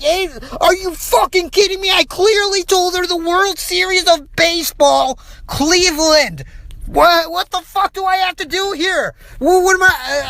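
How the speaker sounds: high-pitched, indignant retelling of his outburst; retold furious outburst. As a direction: replay the outrage, voice up high. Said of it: "I'm fucking furious at this point. I'm like".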